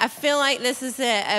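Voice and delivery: usual speaking voice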